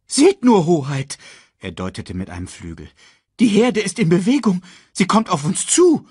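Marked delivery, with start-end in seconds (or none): 0.1-1.2 high, loud cry of alarm; alarmed bird. A narrator's reading. Sasu warns King Mufasa of danger, alarmed. 3.4-6.1 high, loud urgent report; alarmed warning. A narrator's reading. Sasu warns Mufasa about the stampeding herd, alarmed.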